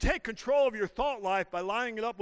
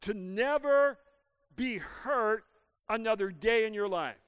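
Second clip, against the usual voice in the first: ordinary delivery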